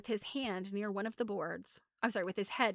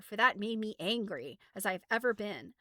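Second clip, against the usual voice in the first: plain reading voice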